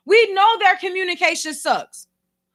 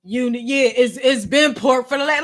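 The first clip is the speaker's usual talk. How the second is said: low, curt, dismissive reply; mild mock attitude, lower pitch; annoyed coworker; 5 semitones lower than usual. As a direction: brush off the question with attitude. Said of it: "He don't get an attitude".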